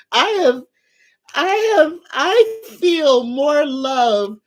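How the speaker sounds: overwhelmed with gratitude, fighting back tears; tearful, choking up. Real, not acted. Thanking her livestream viewers, voice cracking into tears. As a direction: voice breaking high into tears. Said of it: "I see my eyes are watering up".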